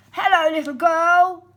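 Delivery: loud, high, sweet-talking wolf; sly wolf greeting. Acted. Wolf calling out to the girl with loud false friendliness. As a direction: lure the girl in with a big friendly hello.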